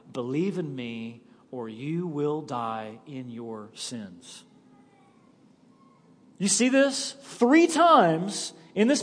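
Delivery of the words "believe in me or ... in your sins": softer and slow, sinking low at the end; solemn quiet warning. Acted. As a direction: let the warning fall to a hush. Jesus's warning, quoted solemnly.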